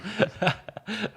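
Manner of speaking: laugh. Real, not acted. Laughing at caveman joke.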